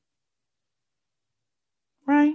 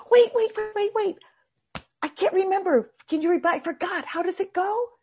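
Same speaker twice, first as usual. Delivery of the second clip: much higher, urgent calls; frantic calling; 11 semitones higher than usual. Retold or imitated. Monk running on water shouts after the boat, frantic. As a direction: chase after the boat in a panic.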